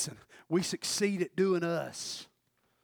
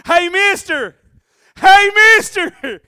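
put-on very high, loud kid calling out; little boy's accent; 23 dB louder, much slower than usual. Boy tugging on Andrew's tunic, high accented holler. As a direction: yell it like a pestering child, drawing it out. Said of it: "I don't know why I give him that accent".

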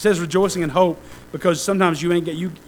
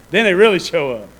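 louder, the word really punched; pushed harder on really; louder repeat; 6 dB louder than usual. Shown as a demonstration. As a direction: say it again with more force.

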